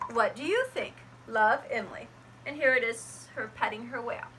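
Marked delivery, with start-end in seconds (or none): none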